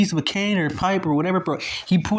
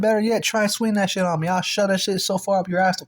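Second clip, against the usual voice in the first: raised, higher-pitched trash talk; heated threat; 5 semitones higher than usual. Retold or imitated. Speaker threatening the man with the pipe, heated. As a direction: act out a worked-up threat.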